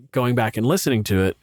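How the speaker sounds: ordinary delivery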